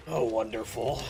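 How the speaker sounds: low, raspy smoker's croak. Real, not acted. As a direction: rasp it like a heavy smoker. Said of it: "I said that like a smoker".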